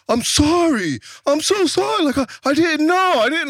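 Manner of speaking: mocking a cowering bully's high-pitched sorry; pleading apology. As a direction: whimper out the apology like a scared big guy. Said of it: "he came up, he was like".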